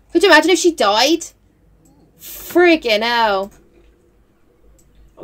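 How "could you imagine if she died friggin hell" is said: ordinary talk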